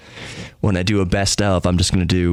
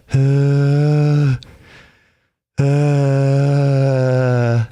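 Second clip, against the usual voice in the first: tight, strangled laugh grunts, higher and louder; strained laughing; 4 semitones higher, 3 dB louder than usual. Shown as a demonstration. Demonstrating to listeners how he laughed with a collapsed lung, strangled laugh grunts. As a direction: hold in a laugh that hurts. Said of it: "That's how I was laughing".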